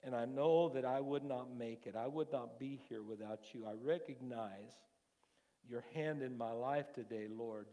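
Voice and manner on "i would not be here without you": normal talking voice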